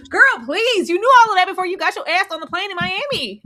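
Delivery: loud, fast sassy callout; sassy scold. Real, not acted. Co-host scolding Elise's choices, loud sassy. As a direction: call her out, sass. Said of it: "scolding Elise".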